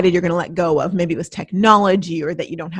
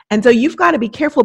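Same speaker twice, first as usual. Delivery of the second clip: ordinary talk, nothing marked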